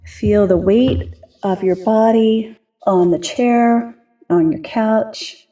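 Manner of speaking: calm grounding exercise. Acted. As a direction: ease them gently into their bodies, unhurried. Leads a grounding meditation.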